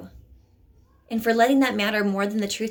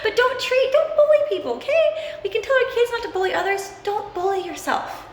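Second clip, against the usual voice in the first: high, giggly talk through real laughter; laughing; 12 semitones higher, 3 dB louder than usual. Real, not acted. Coach laughing at her own joke to viewers, giggly and high. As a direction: laugh it out, pitch way up.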